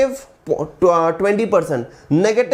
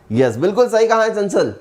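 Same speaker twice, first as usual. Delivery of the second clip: usual speaking voice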